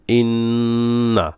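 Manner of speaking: ইন্না held very slow; drawn recitation. Shown as a demonstration. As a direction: stretch ইন্না in tajweed style. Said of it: "এখানে টান হবে".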